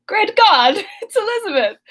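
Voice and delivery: high, loud hammed-up cry; mock alarm. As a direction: gasp it out like a melodrama hero. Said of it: "he's like".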